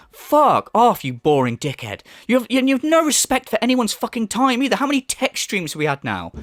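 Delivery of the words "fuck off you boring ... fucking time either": ordinary talk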